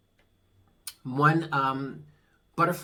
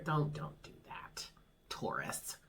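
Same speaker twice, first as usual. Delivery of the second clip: whispered; 2 semitones lower, 14 dB quieter, slower than usual. Real, not acted. Soothing the listener.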